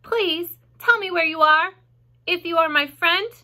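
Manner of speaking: high, loud, formal appeal; earnest public plea, pitched high; loud, firm announcement. A narrator's reading. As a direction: repeat it firmly for the whole barn. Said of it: "I will repeat the message".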